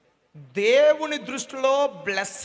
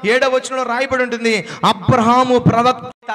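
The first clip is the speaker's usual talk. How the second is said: loud, driving sermon delivery; fervent preaching; 7 dB louder than usual. Acted. Pastor telling of Sara's household, in loud fervent preaching. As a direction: preach it out over the hall.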